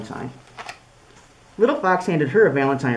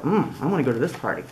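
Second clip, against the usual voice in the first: ordinary delivery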